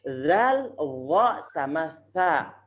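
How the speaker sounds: "Zal, dha sama tha" each said slowly with the tongue between the teeth; slow model letters. Shown as a demonstration. Teacher drills three letters, slow and exaggerated. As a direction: draw out each letter clearly. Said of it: "Antum ingat-ingat".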